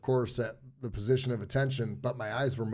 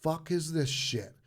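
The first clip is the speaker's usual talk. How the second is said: higher-pitched retold bark; lightly voiced angry sergeant; mock drill instructor; 6 semitones higher than usual.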